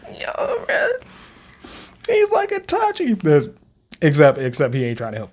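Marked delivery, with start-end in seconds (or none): 0.1-3.2 excited. Real, not acted. Comparing Sukuna to Itachi.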